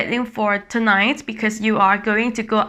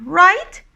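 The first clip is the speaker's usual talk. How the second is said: "Right?" high, loud and rising; 8 semitones higher, 6 dB louder than usual. Shown as a demonstration. Teacher showing the high tone in English, high rising "Right?". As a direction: send "Right?" sharply upward. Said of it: "is the sound of high tone".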